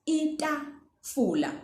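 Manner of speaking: teacher repeats the word slowly; slow word demo. Shown as a demonstration. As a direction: draw out "Itafula" for learners. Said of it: "Then we also have itafula".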